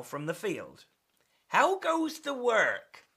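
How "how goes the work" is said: high, drawn-out call; singsong farmer's holler, pitched high. Acted. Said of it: "called the farmer".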